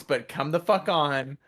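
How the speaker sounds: ordinary talk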